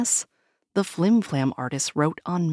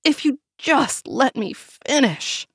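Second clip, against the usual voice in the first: getting mad; 9 semitones higher, 4 dB louder, slower than usual. A narrator's reading. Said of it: "getting mad".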